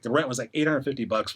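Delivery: ordinary delivery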